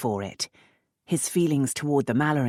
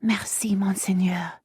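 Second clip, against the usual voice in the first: breathy whispered thanks in French; 7 semitones higher than usual. Acted. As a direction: whisper it clinging to him.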